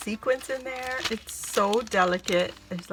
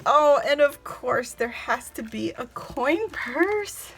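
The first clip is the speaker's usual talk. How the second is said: ordinary delivery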